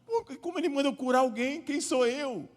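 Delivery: high, fast, panicked outburst; frantic mock panic, pitched high and rushed; desperate king. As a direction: blurt it out like a cornered king in a panic. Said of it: "Ele ficou desesperado".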